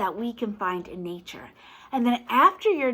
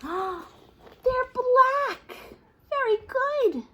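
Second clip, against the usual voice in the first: put-on over-the-top delight for a young child; thrilled reveal; much slower than usual. Reader praises the child's answer with a gasp, up high.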